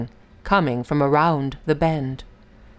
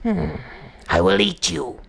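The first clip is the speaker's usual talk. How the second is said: snarling tiger; 2 semitones lower than usual. A narrator's reading. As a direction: growl like a hungry tiger. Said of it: "he snarled".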